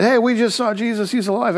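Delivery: high-pitched, eager announcement; excited news. Retold or imitated. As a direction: blurt out the amazing news. Disciples excitedly tell Thomas the news.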